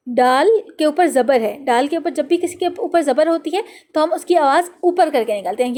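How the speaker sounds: ordinary talk, nothing marked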